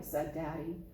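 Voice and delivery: ordinary delivery, nothing marked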